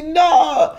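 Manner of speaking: high, drawn-out no; a shocked husband's denial; incredulous no. Retold or imitated. Husband refusing to believe. (mari incrédule, non plus aigu)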